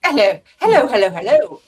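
Ed impression. Guest showing off an impression of the co-host, loud mimicry. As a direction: put on a mimicking voice and boom it out. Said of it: "I can do Ed's voice".

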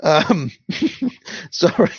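breathless high giggling, apologising; real helpless laughter; fit of giggles. Real, not acted. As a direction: reader cracks up mid-story. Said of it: "something tickled me about that".

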